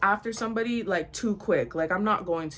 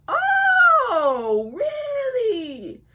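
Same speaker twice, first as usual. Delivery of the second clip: very high, drawn-out squeal; mock astonishment; 6 dB louder, much slower than usual. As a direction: stretch it way up high in exaggerated surprise. Retelling sarcastic reaction to girlfriend news.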